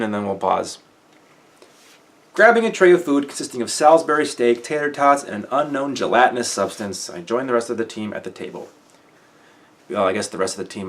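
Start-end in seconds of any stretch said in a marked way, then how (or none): 2.3-8.7 lifted, brighter reading voice; story narration. A narrator's reading. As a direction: carry the listener into the scene. Resumes reading the novel.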